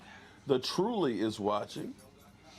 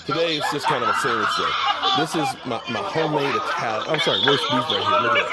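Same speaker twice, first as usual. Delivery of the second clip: laughing; 8 dB louder than usual. Real, not acted. Showing sandwich while laughing.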